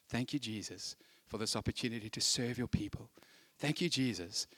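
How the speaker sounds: soft, low prayer of thanks; willing thanks. Acted. Willing helper thanking Jesus while sweeping, quiet and uncomplaining. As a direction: murmur a humble thank-you under the breath. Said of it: "Guys sweeping willingly".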